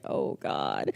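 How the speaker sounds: very low "oh God" of dread. Retold or imitated. Receptionist as the boss kneels down, dreading. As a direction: drop the voice right down, dreading it.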